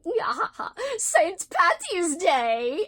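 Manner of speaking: high loud drawn-out leprechaun cheer. Retold or imitated. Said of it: "but instead he goes".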